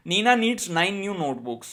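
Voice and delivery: slow, evenly spaced words; slow tongue twister. Shown as a demonstration. Teacher models the Nina twister for pupils, slow. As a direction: read it for the class to copy. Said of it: "Speak them repeatedly".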